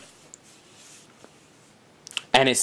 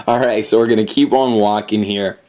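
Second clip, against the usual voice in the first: ordinary delivery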